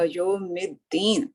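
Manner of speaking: यौमिद्दीन drawn out slowly, in Arabic recitation style; teacher modelling the word यौमिद्दीन slowly. Shown as a demonstration. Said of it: "मालिक यौमिद्दीन में देखिए".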